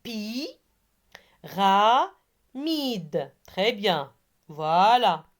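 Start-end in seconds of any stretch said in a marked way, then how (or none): 0.0-3.3 PI, RA, MIDE with long gaps between; syllable by syllable. Shown as a demonstration. Teacher repeats for pupils hunting the sound I, syllables separated. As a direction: isolate each syllable of "Pi, ra, mide". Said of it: "Je répète".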